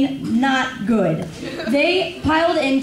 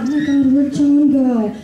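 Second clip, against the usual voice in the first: mild mock panic; 4 dB louder than usual. Friends realizing dad has gone missing, mock panic. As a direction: act out a mild group freak-out. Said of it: "we're all freaking out".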